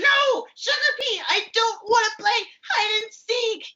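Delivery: high pea voice, a firm no; refusing puppet pea. Acted. Sweet Pea refusing Sugar Pea's game, high and stubborn. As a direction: turn down your friend, high and stubborn.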